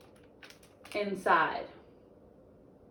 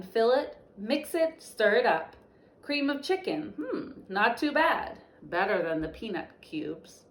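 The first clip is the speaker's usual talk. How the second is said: storybook reading; 4 semitones higher than usual. Acted. Teacher reads picture book aloud.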